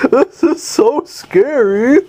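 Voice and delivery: high, slow, hammed-up fake fright; villain mockingly whining about the falling ceiling, high and slow; mock scared villain. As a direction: play a lunatic pretending to be scared, way up high and drawn out. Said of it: "That's what he says, too".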